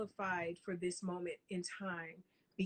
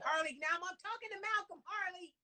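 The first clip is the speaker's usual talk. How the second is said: calling the dog; 10 semitones higher than usual. Real, not acted. Calling the dog mid-talk.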